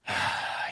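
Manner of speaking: long, soft breathy sigh. Retold or imitated. Prospect about to admit business has changed, quiet sigh. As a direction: let out a quiet, resigned sigh. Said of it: "A sigh".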